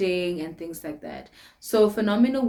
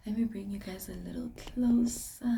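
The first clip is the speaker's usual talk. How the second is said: secretive whisper to the viewers; 7 dB quieter than usual. Pretend. Vlogger drawing her viewers in, whispering. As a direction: lean in and whisper it.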